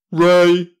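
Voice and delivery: very loud shouted word; booming shout. Acted. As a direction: blast it out like a cannon. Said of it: "shouted the ray gun".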